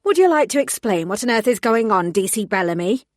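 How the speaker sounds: angry superior snapping down the phone; snapped. Acted. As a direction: snap at the junior officer. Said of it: "she snapped".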